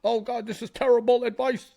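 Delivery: mocking critics. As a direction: mimic the whiny critics, fast and high. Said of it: "Everybody thinks".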